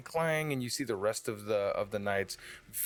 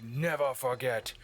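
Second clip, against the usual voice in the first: bullhorn speech; 4 semitones higher than usual. Acted. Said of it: "And he's like".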